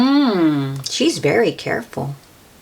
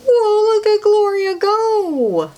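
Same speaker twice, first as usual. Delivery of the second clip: excited whoa; 14 semitones higher, slower than usual. Acted. Reader marvelling at Gloria's tricks, excited. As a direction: cry out in delight at the dog.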